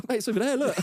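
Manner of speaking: high, amused call-out; gleeful mock shout; excited pointing. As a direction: act out spotting the lost shoe. Retold pointing out the shoe.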